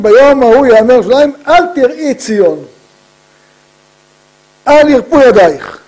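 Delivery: loud, high declamation; rousing verse proclamation. Retold or imitated. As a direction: proclaim the verse to rally the fearful.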